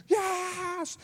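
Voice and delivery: sudden high joyful shout of yes. Retold or imitated. Congregation waking up, shouting a high joyful yes. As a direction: burst out like the whole room just woke up.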